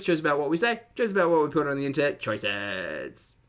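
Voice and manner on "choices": "Choices." dropped low and soft. Shown as a demonstration. Low soft "Choices." to the stream chat. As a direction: sink the word "Choices." down low.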